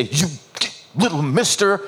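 imitated scolding mom; mommy voice. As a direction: warn a child like Mom. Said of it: "that's the mommy voice".

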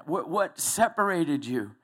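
ordinary talk

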